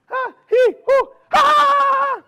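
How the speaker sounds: short high yelps, then a loud high squeal; squirrel yelps. Acted. Squirrel fleeing the bear up the tree, high yelps. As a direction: yip upward and finish in a long squeal.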